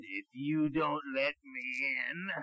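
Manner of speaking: wolf voice. Acted. Wolf threatening the piggy.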